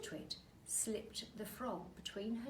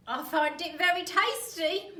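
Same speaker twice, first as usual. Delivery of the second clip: put-on higher, loud smug old wife; Mrs Twit voice; 8 semitones higher, 13 dB louder than usual. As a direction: gloat sweetly. Missus Twit gloating.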